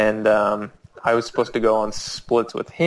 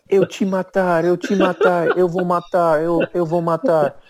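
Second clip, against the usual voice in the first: high-pitched frenzied mock threat; threatening attacker; 7 semitones higher, 4 dB louder than usual. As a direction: imitate a raging knifeman repeating his threat. Retold death threat with a knife.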